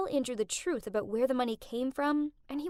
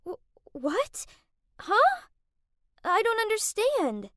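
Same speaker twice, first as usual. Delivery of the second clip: spluttered confusion; 9 semitones higher, 4 dB louder than usual. Acted. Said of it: "Kath spluttered out".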